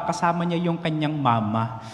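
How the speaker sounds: ordinary talk, nothing marked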